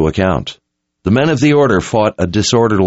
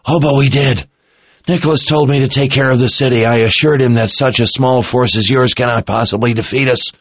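higher, boastful officer's voice; smug captive officer; 7 semitones higher than usual. A narrator's reading. Captured officer boasts to Richard of his defense, smug. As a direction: gloat at the enemy in a higher voice.

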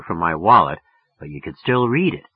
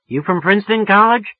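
much higher and louder, interested; curious woman; 11 semitones higher, 5 dB louder than usual. Acted. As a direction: perk up at the college name. Woman questioning the caller.

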